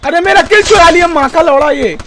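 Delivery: loud, high outraged yell; real anger, shouted; furious shout. Real, not acted. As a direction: blow up at the thief who took your kill. Angry that his kill was stolen.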